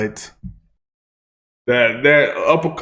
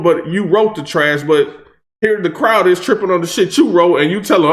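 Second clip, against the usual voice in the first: ordinary talk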